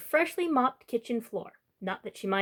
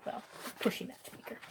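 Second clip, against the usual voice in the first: a soft breathy aside to listeners; whispered aside; 12 dB quieter than usual. Real, not acted. Narrator sharing a wry note with the kids, whispered. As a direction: lean in and confide it.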